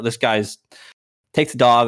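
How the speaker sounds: usual speaking voice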